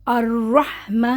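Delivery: الرحمہ slowly drawn, ending in a breathy ہ; pausal recitation. Shown as a demonstration. Teacher showing the pause on الرحمہ. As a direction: stop on الرحمہ and let the ہ breathe out. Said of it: "تو کیا بن جائے گا؟".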